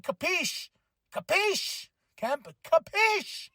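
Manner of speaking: high mocking capiche; lightly voiced unhinged wrestler; imitating Charlie. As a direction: ham up the crazed wrestler. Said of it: "He's like".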